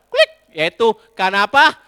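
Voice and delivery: normal talking voice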